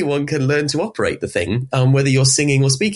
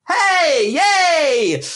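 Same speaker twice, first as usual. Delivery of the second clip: cheer. Shown as a demonstration. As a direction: cheer out away from the mic. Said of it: "if I kind of cheer".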